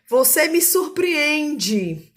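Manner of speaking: "Você me surpreende" drawn out slowly as an exclamation; slow, emphatic exclamation on "surpreende"; exclamative intonation. Shown as a demonstration. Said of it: "olha a entonação".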